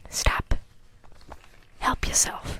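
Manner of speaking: soft breathy meme whisper; a barely voiced stop, help yourself. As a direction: hush it to yourself.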